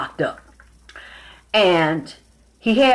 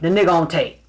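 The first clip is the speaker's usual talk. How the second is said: usual speaking voice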